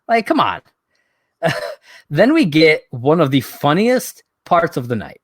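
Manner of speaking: ordinary talk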